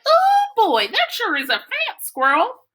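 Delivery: little brother's voice. A narrator's reading. Little brother Cody marvels at the squirrel, high and eager. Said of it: "Cody laughed and said".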